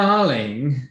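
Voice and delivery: mother greeting her daughter with wine in hand; gushing mother. Acted. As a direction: call out like a tipsy socialite. Drunk mother calls out.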